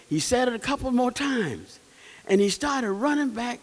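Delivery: usual speaking voice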